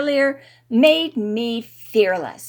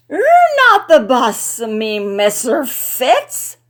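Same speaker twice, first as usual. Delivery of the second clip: loud, slow tipsy scolding; drunken scolding; 3 semitones higher, 9 dB louder than usual. A narrator's reading. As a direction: wag a finger, slurring. Drunk Riley scolds him.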